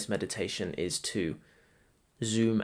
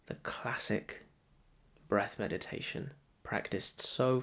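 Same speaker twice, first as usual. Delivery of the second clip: softer, measured meditation voice with long pauses; guided meditation; 7 dB quieter than usual. Acted. Meditation guide to listeners settling into breath practice, soft guided meditation. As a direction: guide them gently into noticing the breath.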